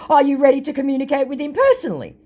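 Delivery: high, quick and pushy; mock scammer voice. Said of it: "To which my scammer said".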